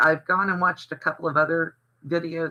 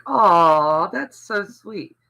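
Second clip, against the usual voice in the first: gushing aw; 3 semitones higher, 6 dB louder, much slower than usual. Retold or imitated. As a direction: gush over a kind gesture, then melt. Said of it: "And I was like".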